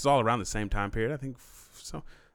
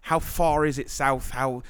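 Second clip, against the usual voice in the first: put-on clueless English tourist accent; feigned English naivety; 3 semitones higher than usual. Faker quizzing ladies on geography, innocently dim in English accent. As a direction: ask earnestly dumb questions in English accent. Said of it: "just say stupid shit".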